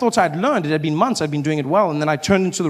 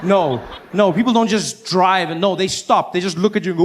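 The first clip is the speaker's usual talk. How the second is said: ordinary talk, nothing marked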